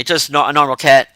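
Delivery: ordinary talk, nothing marked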